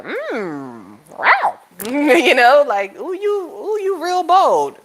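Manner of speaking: impressed flirt. Pretend. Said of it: "the side of me that likes the dark energy be like".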